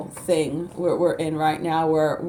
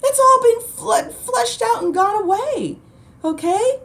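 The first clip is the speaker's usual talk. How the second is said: much higher, laughing "good, good"; gleeful mock scolding; 4 dB louder than usual. Telling upset light workers, a gleeful much higher laughing cry. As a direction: cheer the flood, squealing with laughter. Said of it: "I'm like".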